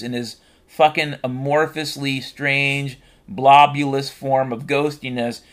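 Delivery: ordinary talk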